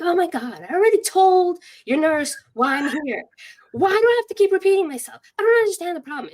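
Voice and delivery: high, loud, exasperated tirade; angry outburst. Retold or imitated. Upset patient snapping at the urgent care doctor, angry outburst. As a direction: re-enact an indignant rant, rushing as it builds. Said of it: "I'm angry at the world".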